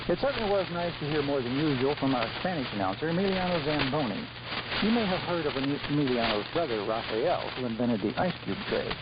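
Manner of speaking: usual speaking voice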